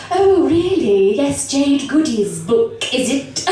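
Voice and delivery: slow, drawn-out RP accent, starting high; condescending RP English accent; intimidating English RP. Shown as a demonstration. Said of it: "English R P, for really thick people that you want to intimidate".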